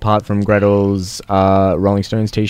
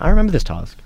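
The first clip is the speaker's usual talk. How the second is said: mum voice; 9 semitones higher, faster than usual. Retold or imitated. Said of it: "my mum came out and she went".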